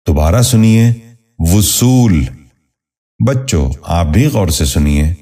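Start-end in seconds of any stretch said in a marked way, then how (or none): 1.4-2.3 وصول said as "vu-sool", short u after v; correct pronunciation. Shown as a demonstration. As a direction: open وصول with "vu". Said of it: "درست تلفظ ہے".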